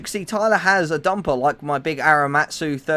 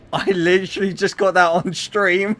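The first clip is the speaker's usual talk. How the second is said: laughing; 4 semitones higher, 4 dB louder than usual. Real, not acted. Laughing while speaking.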